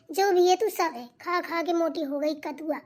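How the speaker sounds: ordinary talk, nothing marked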